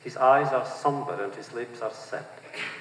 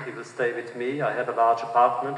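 plain reading voice

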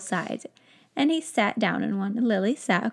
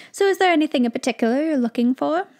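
fast, a bit higher and eager; excited wizard shopkeeper; 5 semitones higher, 4 dB louder, faster than usual. Acted. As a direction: rush to help the customer.

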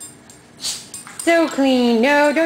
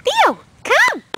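high sung-out call to a dog. Real, not acted. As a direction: call him across the room. Calling the dog over.

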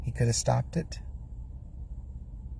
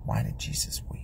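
breathy whispered question; whispered, searching, to the whole church; 6 dB quieter than usual. Acted. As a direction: ask it again under your breath.

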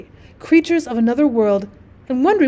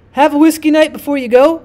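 raised, louder hearty call; 4 semitones higher, 7 dB louder than usual. A narrator's reading. Host offering departing guest a drink, calling out. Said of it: "called Harry".

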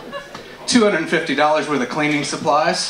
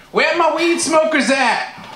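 mock comic crowd call; 10 semitones higher, slower than usual. Mocking other comics' crowd call.

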